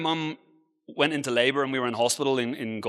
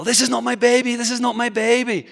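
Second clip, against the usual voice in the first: retold hysterical cry, pitched much higher; frantic, high-pitched protest, imitating a panicked mother; 9 semitones higher, 4 dB louder than usual. Speaker's mum protesting to hospital staff over the baby, hysterical. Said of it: "is hysterical".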